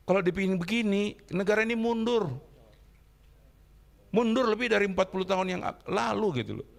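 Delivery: high, heated outrage; outraged. Real, not acted. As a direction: cry out in outrage. Outraged speaker condemning disruption.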